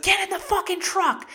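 very high, loud yelled command; urgent mock shout. Ruth ordering Ben into the truck, acted yelling. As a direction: imitate a frantic order, shrill and shouted.

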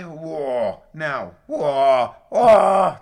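loud rising cries of disbelief; shocked whoa. Real, not acted. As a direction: blast it out, stunned by what he heard. Shocked by bassist insult.

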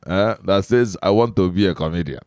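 put-on Igbo interviewer's accent. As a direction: ask it as a playful Igbo man. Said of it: "let me switch into my accent".

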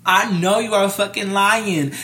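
high, loud, drawn-out protest; retold indignant disbelief. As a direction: act out loud, slow disbelief.

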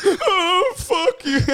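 high-pitched fake sobbing through laughter; mock crying and booing. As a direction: wail it in hammed-up tears, laughing. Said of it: "Crying and booing".

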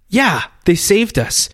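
put-on eager boy. Boy starts his big story.

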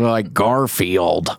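Garfield drawn out slowly in an American accent. Shown as a demonstration. Showing the guest how, very slow American accent. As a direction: drag the words out in a broad American accent. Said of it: "that sounds like".

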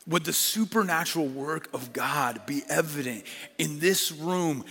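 ordinary delivery

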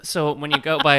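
loud, high turkey voice; turkey impression. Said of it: "Can I do my turkey impression?".

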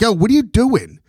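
high-pitched mock yell, no real volume; half-voiced yell of disbelief at another coach; retold coach's yell. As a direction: imitate an indignant coach calling out. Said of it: "other coach is yelling at the wood coach".

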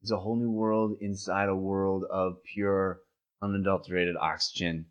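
soft, dreamy stoned voice; awed and spaced out; stoner. Acted. Acting high, whole new world.

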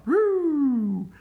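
high, loud drawn-out "woo"; imitated siren whoop. Storyteller mimicking a car lighting up, loud high whoop.

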